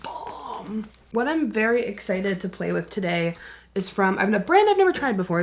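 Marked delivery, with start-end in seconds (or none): 0.0-0.9 a soft, breathy hushed whisper; delighted whisper. Real, not acted. Vlogger loving the sugar-cookie scent, softly whispering. Smelling setting spray. 4.3-5.4 higher, louder and fast with eagerness; excited burst. Real, not acted. Vlogger introducing a brand she's eager to try, excitedly. Excited about new palette.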